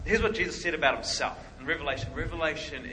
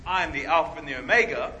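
solemn declaration; 8 dB louder than usual. Pretend. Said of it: "When Jesus gets up and says".

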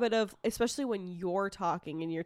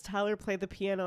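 usual speaking voice

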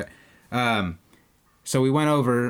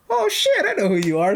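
much higher and louder, thrilled; excited recognition; 12 semitones higher, 5 dB louder than usual. Retold or imitated. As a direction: squeal it as it dawns on you who he is. Realizes who the fan is.

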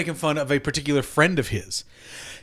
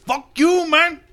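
put-on sing-song Chicano stoner accent, swinging pitch; Cheech Marin impression. Comic as Olmos taunting humans, exaggerated Chicano accent. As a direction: caricature a swaggering Chicano stoner. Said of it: "will be played by Cheech Marin".

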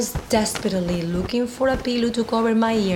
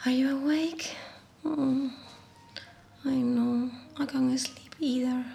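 usual speaking voice

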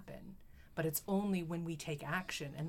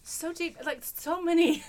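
high, loud and gushing; excited; 11 semitones higher, 11 dB louder than usual. Real, not acted. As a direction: gush about the poem. Reacting to the poem.

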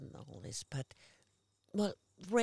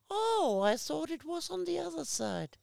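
high-pitched, surprised "oh"; acted surprise; 11 semitones higher than usual. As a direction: re-enact it high and taken aback. Acts out surprised line in episode.

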